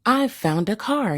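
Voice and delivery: a small hamster yelling his find; yelled. Acted. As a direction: call it out proudly across the room. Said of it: "yelled Mo".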